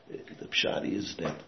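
ordinary talk, nothing marked

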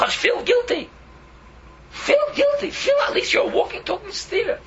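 anguished wail; crying out. Real, not acted. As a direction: let the guilt burst out in tears. Crying out in guilt.

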